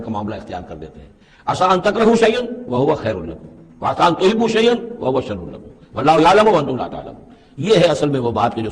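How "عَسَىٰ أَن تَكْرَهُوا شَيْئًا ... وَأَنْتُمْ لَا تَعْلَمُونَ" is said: melodic tilawat, lifted and flowing; Quran recitation. Acted. Teacher recites the verse to his students, melodic tilawat. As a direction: chant the verse like a reciter, quickening at the end.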